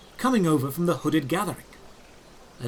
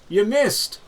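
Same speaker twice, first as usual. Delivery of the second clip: louder, higher teasing call upward; playful shout; 4 semitones higher, 5 dB louder than usual. Acted. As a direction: shout it up cheekily to the guard. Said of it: "playfully shouted up to the guard".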